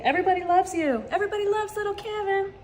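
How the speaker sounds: high, sing-song cooing; doting high-pitched pet voice. Real, not acted. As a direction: coo sweetly to a beloved cat. Cooing affection to her cat.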